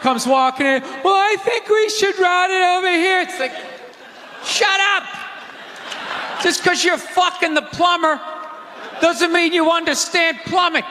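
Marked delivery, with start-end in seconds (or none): 1.0-3.2 much higher prissy know-it-all voice; mock wife. Comic voicing plumber's wife butting in, high and prissy. 4.4-10.8 higher exasperated yell; mock angry rant. Comic ranting at plumber's wife, exasperated yell.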